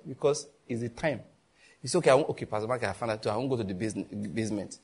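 usual speaking voice

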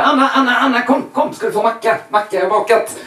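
loud, high eager call; calling out to wife. Retold or imitated. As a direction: call her in, proud of the bread. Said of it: "Så ropar jag på min fru". (mannen ropar ivrigt och högt på sin fru)

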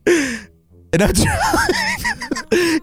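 giggling through the words, slowed and high; laughing. Real, not acted. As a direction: laugh helplessly while talking. Laughing while emotional.

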